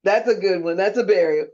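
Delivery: ordinary talk, nothing marked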